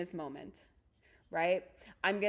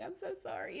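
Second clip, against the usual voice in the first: sheepish apology; 8 semitones higher, 9 dB quieter, faster than usual. Retold or imitated. Apologizing for hobo outfit.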